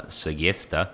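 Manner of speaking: put-on slow, playful Italian accent on the town name. Gamer naming an Italian town in a mock Italian accent. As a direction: roll out Segesta like an Italian local. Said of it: "I don't know why I said that with an accent".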